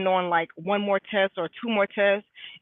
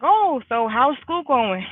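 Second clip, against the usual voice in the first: higher, louder, drawn-out mom question; slow, lifted mock-mom inquiry; typical mom; 5 semitones higher, 6 dB louder, much slower than usual. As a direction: imitate a mom asking, stretched out and high. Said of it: "typical mom".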